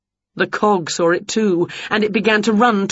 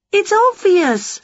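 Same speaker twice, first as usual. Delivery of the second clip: high, lively witch voice; bright eager witch teasing her pets; Winnie's voice; 13 semitones higher, 3 dB louder, slower than usual. A narrator's reading. Witch quizzing the dog and Wilbur, high and lively.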